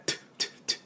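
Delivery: voiceless soft "トゥ" bursts; aspirated t on "トゥ"; spitting t. Shown as a demonstration. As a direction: puff "トゥ" as if spitting. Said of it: "唾を飛ばすような音".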